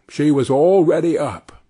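plain reading voice

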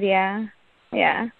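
normal talking voice

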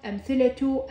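أمثلة said amthilatu, final tu; voweled t ending. Shown as a demonstration. Teacher showing the spoken t, أمثلة ending in tu. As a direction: end أمثلة on a clear tu. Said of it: "هنا لفظتها تاء".

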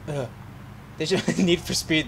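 ordinary talk, nothing marked

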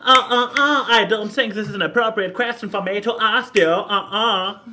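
loud, high put-on sassy voice; overdone sassy American accent.

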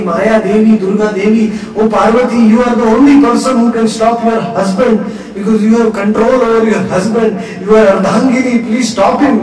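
loud, high urgent pleading; imploring demigods. Acted. Demigods imploring Parvati to stop her husband, loud urgent plea. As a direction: plead with her as the only hope.

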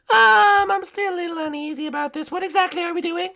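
high, hesitant princess voice; high cartoon princess, doubtful; uneasy princess. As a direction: ask warily in a high voice.

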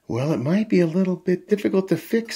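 lifted voice casting doubt; Daddy Pig's doubt. A narrator's reading. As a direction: hedge like an unsure dad. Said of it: "says Daddy Pig".

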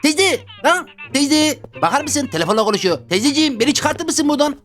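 put-on high, pleading little-kid voice; whiny kid voice. Trapped in kindergarten, begging the caretaker lady: high whiny kid voice. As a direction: play a grown man stuck in kindergarten, whining.

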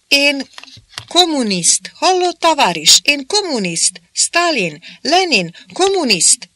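high, loud broken speech to Russian soldiers; broken-Hungarian pleading. A narrator's reading. Smith explaining to the Russian soldiers, high and pleading. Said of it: "ő meg magyarázott nekik".

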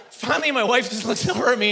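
ordinary delivery

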